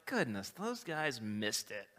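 low, soft, slow tut-tutting; head-shaking disapproval. Retold or imitated. Churchgoers tut at the nine ungrateful men, low and slow. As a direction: shake your head over them. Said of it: "we're like".

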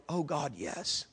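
very soft prayer response; hushed reverent assent. Retold or imitated. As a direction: imitate a quiet, awed yes to God. Elders' hushed response to God, acted out.

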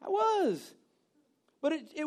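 high, laughing confession; laughing admission. Real, not acted. Preacher admits being upset to congregation, laughing high.

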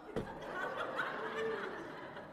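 high burst of laughter. Real, not acted. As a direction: laugh at your own joke. Laughing at own pun.

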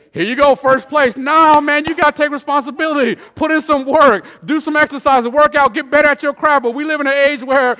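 loud, high, driving exhortation; full-force preaching. Real, not acted. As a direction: preach it hard at the congregation.

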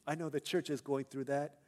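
ordinary talk, nothing marked

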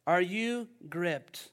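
ordinary delivery

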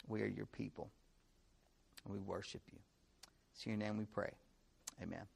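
low, soft prayer voice. Real, not acted. Closing an opening prayer.

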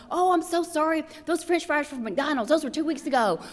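high, flustered mock apology; car owner apologizing to a passenger, high and flustered; embarrassed apology. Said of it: "you go".

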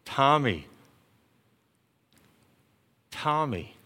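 gently calling a name, very soft. Acted. God quietly calling someone's name, very soft. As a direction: call the name like a quiet inner voice.

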